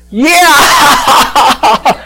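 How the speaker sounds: high "yeah" dissolving into laughter; reenacted helpless laughing. Preacher telling a worried onlooker he's okay, acted helpless laughter. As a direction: answer through a huge laughing fit. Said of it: "And I laughed so hard".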